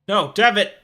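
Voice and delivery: loud, high desperate cry; alarmed cry. Acted. Player crying out as Devitt is taken, loud desperate shout.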